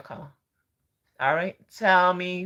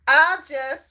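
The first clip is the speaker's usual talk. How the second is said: high, loud Southern drawl; mock Southern accent, pitched way up; Southern gentleman; 11 semitones higher, 10 dB louder than usual. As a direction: imitate a gushing Southern man. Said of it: "he was Southern".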